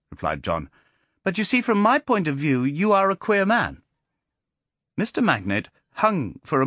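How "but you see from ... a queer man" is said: put-on high, cheeky child's voice; a clever boy turning the tables; precocious boy. A narrator's reading. Boy John speaks.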